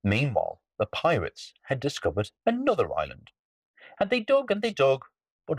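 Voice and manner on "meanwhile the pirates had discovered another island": usual speaking voice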